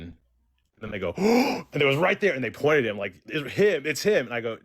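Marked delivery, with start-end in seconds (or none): 1.2-1.7 high, startled mock gasp; sharp high gasp of disbelief; shocked gasp. 3.3-4.3 higher-pitched, imitating stunned teens; urgently single him out; retold excitement, voice lifted; excited pointing-out.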